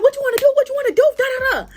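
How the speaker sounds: very high-pitched mocking taunt; boosting up. Rival hyping herself up to fight, mocking high-pitched imitation. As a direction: mimic her hyped-up challenge in a squeaky pitch. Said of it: "boosting up hard as fuck".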